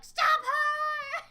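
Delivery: high, very loud yell; panicked mock shout. Imitating everyone reacting to Maki, high panicked yell. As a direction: shout it as a whole panicking crowd would. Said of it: "Everyone was like".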